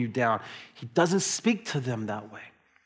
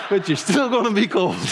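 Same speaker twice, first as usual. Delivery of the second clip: louder and higher, teasing through laughter; laughing; 8 semitones higher, 6 dB louder than usual. Retold or imitated. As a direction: tease your son while cracking up. Teasing son about tank tops.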